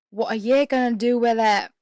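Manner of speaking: loud, badly faked Australian accent; attempted Australian accent. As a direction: try an Australian accent that slips into British.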